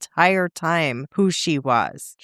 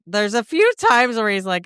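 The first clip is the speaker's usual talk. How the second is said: normal talking voice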